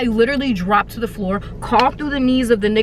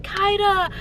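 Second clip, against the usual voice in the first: high sing-song mock calling; calling her name; 8 semitones higher than usual. Mimicking the friends calling to lure her out. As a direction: coax like a fake-friendly seeker. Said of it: "They're like".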